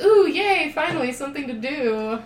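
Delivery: high, loud pretend glee; playful burst of put-on excitement; mock delighted cop. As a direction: cheer like a bored cop finally thrilled. Imagines the bored cop's delight.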